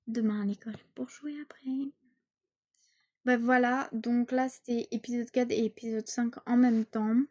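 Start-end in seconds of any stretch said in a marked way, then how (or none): 0.1-1.9 whisper. Acted. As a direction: whisper it dreamily in bed. Girl whispers in bed.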